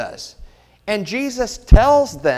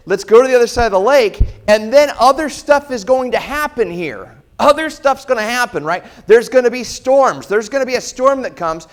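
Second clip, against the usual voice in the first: preaching at full force; 3 semitones higher, 6 dB louder than usual. Real, not acted. Preacher warning the church about coming storms, loud and fast. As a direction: raise it up, louder and faster, to the crowd.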